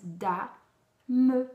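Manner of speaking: dame split into separate Da and me; Da and me said apart; split into syllables. Shown as a demonstration. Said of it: "il y a deux syllabes".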